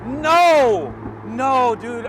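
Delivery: high, loud overwhelmed cries; real elated shouting; real ecstatic yelling. Real, not acted. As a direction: cry out in disbelief at the speed. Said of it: "I'm just yelling a bunch of nonsense now".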